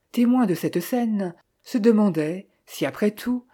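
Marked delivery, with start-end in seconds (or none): none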